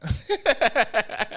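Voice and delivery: high, rolling laughter; long amused cackle; laughing. Real, not acted. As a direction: crack yourself up at your own joke. Laughs at her own joke.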